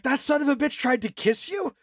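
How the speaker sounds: hammed-up indignant boyfriend; retold overreaction. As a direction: overdo the jealous anger. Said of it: "he says too much".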